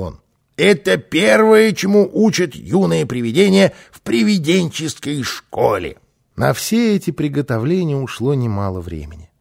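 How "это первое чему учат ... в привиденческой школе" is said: high, loud know-it-all ghost; emphatic Karlsson. A narrator's reading. Karlsson lectures on ghost school, loud and emphatic. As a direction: declare it grandly, dropping at the end.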